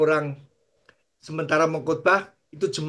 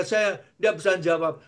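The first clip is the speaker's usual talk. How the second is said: ordinary talk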